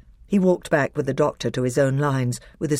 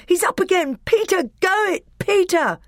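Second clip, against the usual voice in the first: high excited cheering; boy cheering his brother, high and excited; 3 dB louder than usual. A narrator's reading. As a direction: urge Peter on, thrilled.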